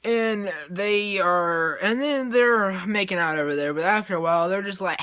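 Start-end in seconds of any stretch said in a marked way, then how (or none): none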